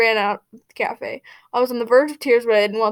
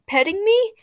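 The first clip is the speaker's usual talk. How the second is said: flustered; 10 semitones higher, 5 dB louder than usual. Acted. Flustered question while being petted.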